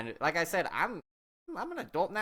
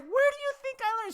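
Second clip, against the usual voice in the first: very high-pitched, incredulous question; re-enacted exasperated retort; indignant comeback; 4 dB louder than usual. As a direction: throw the accusation right back, voice way up. Turns accusation on aunt.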